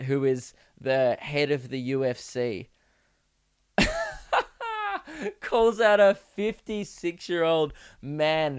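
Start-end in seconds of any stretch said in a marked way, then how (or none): 3.8-5.4 high, wheezing real laugh; real high-pitched cackle; burst of laughter. Real, not acted. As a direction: crack up helplessly. Cracks up at his own story.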